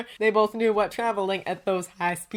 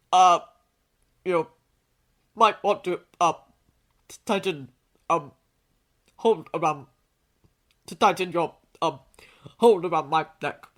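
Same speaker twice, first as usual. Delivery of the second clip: halting, stammered request with long pauses; awkward stop-start fumbling; flustered hesitation; 4 dB louder than usual. A narrator's reading. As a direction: stumble awkwardly, word by word. Hero awkwardly asks for tighter hold.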